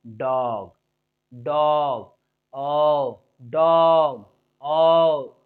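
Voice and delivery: "डॉग" with a long, low, rounded vowel; open ओ vowel. Shown as a demonstration. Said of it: "ओ का क्या है".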